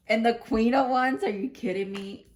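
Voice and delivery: loud, high burst of disbelief; surprised. Real, not acted. Card draw surprise, loud.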